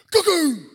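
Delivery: explosive high mimicked crash; sudden, very loud imitated thud; loud impact noise. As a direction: burst out with a startling bang noise. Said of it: "I heard this".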